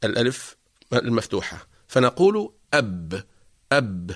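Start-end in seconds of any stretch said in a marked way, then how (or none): 2.7-3.2 "أب" stopped on a vowelless b after the hamza; consonant with sukoon. Shown as a demonstration. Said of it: "ننطق بها نسبقها بهمزة". 3.7-4.1 low "أب", shut on a vowelless b; consonant with sukoon. Shown as a demonstration. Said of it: "ننطق بها نسبقها بهمزة".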